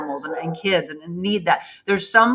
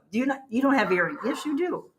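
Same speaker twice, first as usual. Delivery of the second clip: ordinary talk, nothing marked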